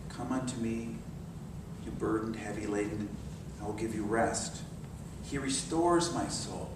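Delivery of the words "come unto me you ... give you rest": much softer, lower and gentle; tender scripture quote. Acted. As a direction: invite them in softly. Quoting Jesus tenderly.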